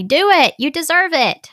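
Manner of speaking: high, emphatic cheering-on; urging encouragement. Acted. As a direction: urge a best friend to go for it. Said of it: "what would you tell your daughter or your best friend".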